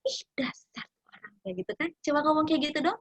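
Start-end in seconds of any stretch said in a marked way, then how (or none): 0.0-1.3 breathy under-the-breath sneer; a soft but cutting jab; quiet snide remark. Retold or imitated. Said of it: "dia ngomongnya biasanya cuma".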